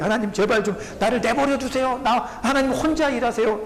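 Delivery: a worn-out believer begging God; mild mock exasperation; weary plea. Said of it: "내가 힘들고 어려울 때".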